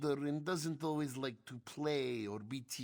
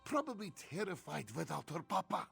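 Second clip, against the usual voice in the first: lilting third-person creature voice; Ishu character voice; 11 semitones higher, 3 dB louder than usual. Acted. Ishu begging for help finding his child, worried. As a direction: plead in a purring, worried manner. Plays Ishu, cat-like NPC.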